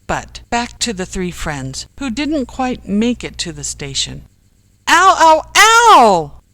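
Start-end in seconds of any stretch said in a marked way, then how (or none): 4.9-6.4 high, very loud cries of pain; sudden pained yell. A narrator's reading. Ken pelted by stones, sudden yell. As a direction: yelp as if suddenly hit. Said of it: "suddenly yelled Ken".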